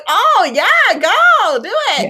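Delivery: high, loud fangirl cheer. Retold or imitated. Cheering Kate on while retelling the fight, loud high excitement. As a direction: egg her on like a fan in the stands. Cheering on Kate's blood spikes.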